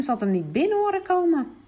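ordinary delivery

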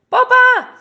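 high, loud cry for papa; loud call. A narrator's reading. Lost girl shouting for her father, loud high call. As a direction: call out into the dark woods. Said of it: "roept ze hard".

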